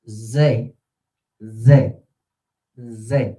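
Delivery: Z sounded out loud and alone; stress on Z. Shown as a demonstration. Teacher drills the stressed syllable, punching Z. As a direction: hit the syllable Z hard. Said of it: "which syllable is stressed the most?".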